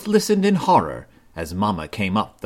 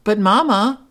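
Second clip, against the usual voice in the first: high, louder cub protest; childish protest; 12 semitones higher, 5 dB louder than usual. A narrator's reading. Sister Bear protesting to Mama, insistent. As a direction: object like a puzzled child. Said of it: "protested sister".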